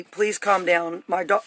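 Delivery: usual speaking voice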